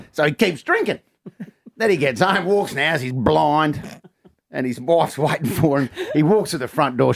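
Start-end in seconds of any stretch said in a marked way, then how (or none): none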